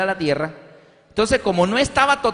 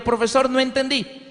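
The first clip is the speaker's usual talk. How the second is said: higher, polite plea from the classmates; students asking; 6 semitones higher than usual. Retold or imitated. Classmates ask the math teacher to repeat, higher. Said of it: "le dicen los compañeros".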